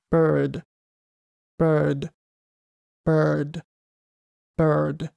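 "bird" with an R-coloured vowel; isolated "bird", careful English R shaped differently each take; four R methods. Shown as a demonstration. Teacher reading words for comparison, four English R methods. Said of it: "全四つの方法でここに用意した単語を読んで".

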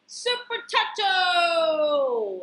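loud, high, drawn-out superhero title; big announcer flourish; grand title announcement. Acted. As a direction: belt out the title like a fanfare. Announcing the book title excitedly.

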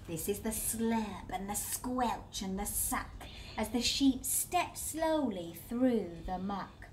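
usual speaking voice

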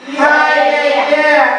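high, punchy yeah yeah; hammed-up catchphrase; Sérgio Malandro cheer. Shown as a demonstration. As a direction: cheer it like a kids' TV host. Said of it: "Esse é o Sérgio Malandro".

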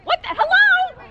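high startled hello; startled by another driver, high and sharp. Real, not acted. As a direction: yelp it up high. Car cut-off.

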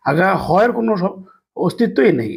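ordinary talk, nothing marked

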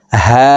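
"হা" from the start of the throat, vowel briefly held; one-alif stretch. Shown as a demonstration. Teacher demonstrating ha, short even hold. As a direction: stretch "হা" for one measured beat. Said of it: "হলকের শুরু থেকে উচ্চারণ করব".

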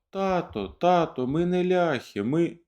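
put-on high, pleading children's voices; children begging their father; babbling children. A narrator's reading. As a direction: beg as little kids cut short. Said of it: "белькотало".

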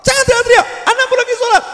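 very high, loud mock screech; out-shout the child in a hammed-up yell; mother's screaming scold. Mother yelling at child during prayer, screeched mock scolding. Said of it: "suaranya ngungkuli anakmu".

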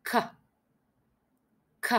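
k sound alone; a short crisp k; isolated English k. Shown as a demonstration. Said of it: "Sẽ có âm".

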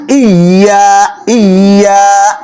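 ইয়া said slowly and lightly, no hard stress; ইয়া drawn out gently, not pressed into জ; soft ইয়া. Shown as a demonstration. Teacher models ইয়া without pressing it. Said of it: "এত জোরে চাপ দেওয়া যাবে না".

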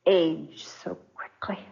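whispered to herself, stunned; a hushed, haunted echo; frightened whisper. Acted. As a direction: breathe the words back, shaken. Wife echoes husband's cruel remark.

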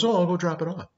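lightly voiced eager secretary; helpful secretary. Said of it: "she goes".

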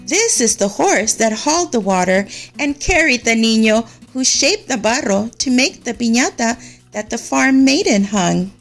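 usual speaking voice

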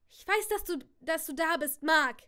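loud, high furious shout. A narrator's reading. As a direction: shout into the empty room. Aunt shouts at reaper.

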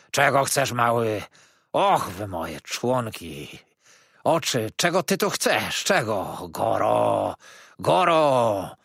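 put-on crazed old man shouting with long wailed cries; energetic yelling. A narrator's reading. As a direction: rant loudly, stretching the cries. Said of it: "wrzeszczał".

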